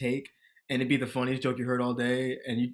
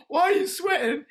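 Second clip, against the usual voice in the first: higher incredulous question; puzzled asking sweating Leslie, higher; baffled; 14 semitones higher, 3 dB louder than usual. Retold or imitated. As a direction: ask it in disbelief, high. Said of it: "I said".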